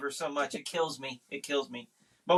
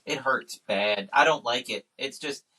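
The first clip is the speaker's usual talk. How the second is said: ordinary talk, nothing marked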